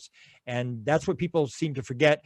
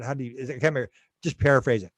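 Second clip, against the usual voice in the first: ordinary delivery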